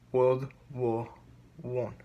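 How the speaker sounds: low, hushed dramatic reveal; sinking to a hush on the last word; ominous reveal. Acted. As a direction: drop it low like a trailer voice.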